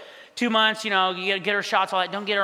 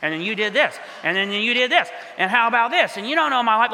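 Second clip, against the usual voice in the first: inner rant. Retold or imitated. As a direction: start under the breath and build into mock indignation. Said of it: "I'm thinking in my head".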